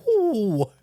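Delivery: high, loud mock "Whoo"; imitating someone blowing on hot food; playful high "whoo" of a character cooling hot food; whoo sound. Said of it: "He's like".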